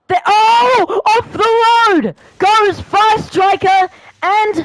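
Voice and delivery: high, loud cry of shock at a crash; surprised shout. Real, not acted. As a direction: yell out as the cars spin off. Sudden crash in the race.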